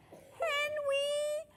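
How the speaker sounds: very high lisping coo; lisping little girl. A narrator's reading. Lily wanting to kiss Henry, high lisp. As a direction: pipe it in a tiny lisping voice. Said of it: "lisped Lily".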